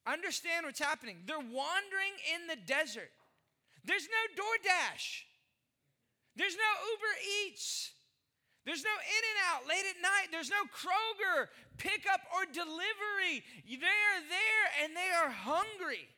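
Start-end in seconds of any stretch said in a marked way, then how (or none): 3.8-13.4 higher, punching each no; emphatic list. Acted. Pastor to church, listing what the desert lacked, emphatic. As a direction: hammer every no up high. Listing missing food options.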